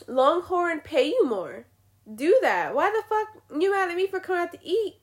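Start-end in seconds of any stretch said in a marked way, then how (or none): 2.7-5.0 exasperated rant. Real, not acted. Ranting at waiter over tips.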